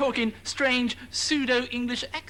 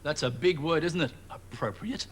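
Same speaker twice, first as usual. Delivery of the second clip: condescending; 7 semitones lower than usual. Acted. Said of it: "nice and condescending of Glenn".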